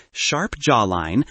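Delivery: ordinary delivery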